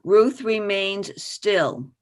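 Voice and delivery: ordinary delivery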